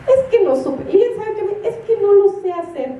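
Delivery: put-on high whiny excuse from a child; whiny daughter. Mother imitating her daughter Lian's high whiny excuse. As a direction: whine it like a girl dodging chores. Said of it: "Lian así me dice".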